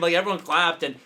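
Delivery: usual speaking voice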